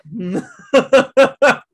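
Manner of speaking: high, loud burst of laughing; real loud laughter. Real, not acted. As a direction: crack up at your own punchline. Cracking up after the punchline.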